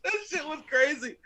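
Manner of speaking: usual speaking voice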